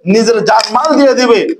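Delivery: ordinary talk, nothing marked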